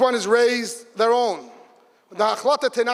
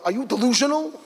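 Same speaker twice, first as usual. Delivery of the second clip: incredulous self-talk; 7 semitones higher than usual. Retold or imitated. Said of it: "But then I said to myself".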